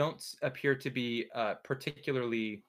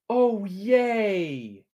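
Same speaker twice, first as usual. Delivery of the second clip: bright delighted squeal of yay; happy little cheer at the roll; excited cheer; 7 semitones higher, 8 dB louder than usual. Real, not acted.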